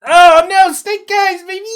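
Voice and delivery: very high, gleeful cry; gleeful whoop. Real, not acted. Game master reacting to player's snake eyes, gleeful. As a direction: crow over the bad roll.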